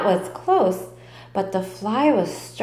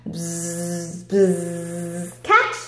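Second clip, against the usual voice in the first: playful fly call; buzzing call; 4 semitones lower, much slower than usual. Acted. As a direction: buzz and toss the ball. Fly calls catch.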